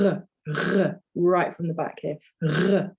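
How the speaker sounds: r rolled right at the back of the throat, French style; French throaty r. Shown as a demonstration. Teacher demonstrates the French r, throaty from the back. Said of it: "you get right to the back of your throat".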